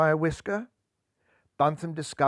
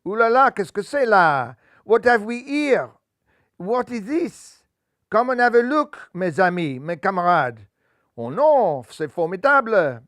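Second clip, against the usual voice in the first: higher, louder excited French chef; French accent; 5 semitones higher, 6 dB louder than usual. Acted. French chef calling his mates over to his find, in a French accent. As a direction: exclaim in a hammy French accent.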